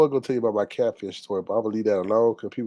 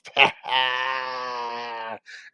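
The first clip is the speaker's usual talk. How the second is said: long cackle trailing away; drawn-out cackle. Real, not acted. Streamer tickled by her sound bites catchphrase, long cackle. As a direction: cackle and hold the last note.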